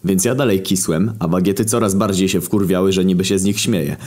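ordinary delivery

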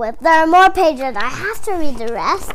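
plain reading voice

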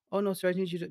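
high, loud imitation of the caller; mock scam caller. Retelling the scam caller before he hung up. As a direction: squeak out the caller's polite plea. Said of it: "scam caller voiced".

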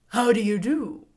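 lightly voiced husky straw man; husky scarecrow. A narrator's reading. Scarecrow politely greeting the girl, husky. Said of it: "in a rather husky voice".

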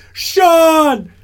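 high, loud repeated desperate calls; frantic yelling. Retold or imitated. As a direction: yell the name over and over, panicking. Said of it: "will just say".

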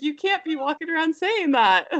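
high-pitched parental telling-off; mock scolding. Mom warns toddler about swearing, high mock scolding. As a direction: chide a small child playfully.

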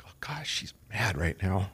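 hushed, laughing whisper about his wife; whispered aside. Retold or imitated. Husband whispering to friend that his wife is mad. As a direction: breathe it like a secret warning. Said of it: "It's like".

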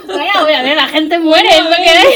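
loud, laughing 'people die'; laughing blunt quip. Real, not acted. Joking to friends about everyday problems, loud and laughing. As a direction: blurt it out through laughter.

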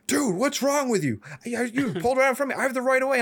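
high, loud, slowed outraged yell; angry biker. Retold or imitated. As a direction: tell the driver off for cutting you. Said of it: "He's like".

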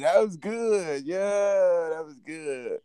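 high, slow put-on voice; mock-grand praise for the singer; hammed-up praise. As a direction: stretch it out in a silly high voice.

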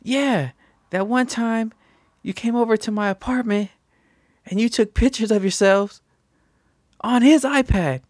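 high, increasingly loud retelling of her accusation; accusing friend. Retold or imitated. Voicing Hillary's accusation.

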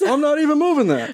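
very high, freaked-out denial; hammed-up high-pitched alarm; mock protest. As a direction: play a spooked séance player insisting it isn't you. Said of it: "a bunch of people going".

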